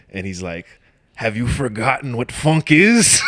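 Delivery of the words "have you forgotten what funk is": loud, high mock Russian accent. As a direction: imitate an indignant Russian critic, pitched up. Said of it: "like, Russian dude".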